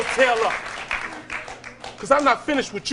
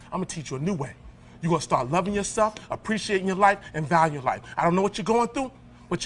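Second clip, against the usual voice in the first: much softer and lower, tender promise; gentle encouragement; 8 semitones lower, 7 dB quieter than usual. Real, not acted. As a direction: win her over quietly. Shifts to encouraging the teen.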